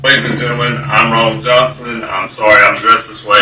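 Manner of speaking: ordinary talk, nothing marked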